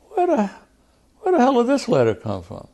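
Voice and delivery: high-pitched, exasperated retold outburst; retold indignant demand. Congressman demanding answers from his secretary, indignant. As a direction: re-enact demanding an answer in outrage.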